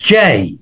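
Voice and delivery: জেই ending in a clear ই glide; correct letter J. Shown as a demonstration. Teacher models the letter J for students, ending in a glide. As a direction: say জেই, not জে. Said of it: "জের উচ্চারণ হচ্ছে জেই".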